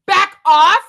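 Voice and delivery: mock shout. Defending her fire sign, playful shout. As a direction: bark it like a warning. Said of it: "I have to defend ... and just say".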